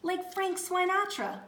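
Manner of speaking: high, loud eager cry; excited cry. Acted. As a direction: gush about her. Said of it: "cried Mister Lamb".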